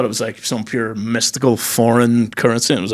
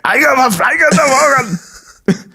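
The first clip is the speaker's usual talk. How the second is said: put-on drunk German uncle; 10 semitones higher than usual. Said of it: "he gets drunk and he's like".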